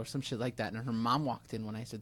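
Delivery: ordinary delivery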